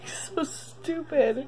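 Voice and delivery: slow, high, laughing through tears; tearful self-reproach. Real, not acted. Diarist embarrassed at crying on camera, tearful. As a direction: scold yourself while crying.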